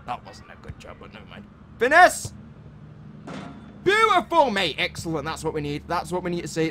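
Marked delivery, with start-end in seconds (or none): 1.8-4.8 excited. Real, not acted.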